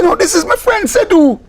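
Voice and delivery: high, insistent correction; insisting. Retold or imitated. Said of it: "I say".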